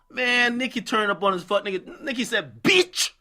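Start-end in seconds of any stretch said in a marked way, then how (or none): 2.6-3.1 a sharp imitated jab; mock yell. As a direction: bark it as the opener of the quote. Nikki quote opens with insult.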